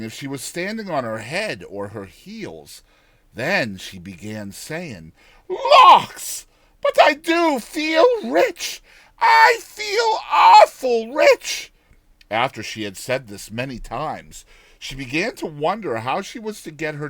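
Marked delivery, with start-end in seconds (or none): 5.5-11.7 put-on very high, loud, slow old woman savouring it; giddy old woman. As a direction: relish every word like a lottery win. Old woman marveling at riches.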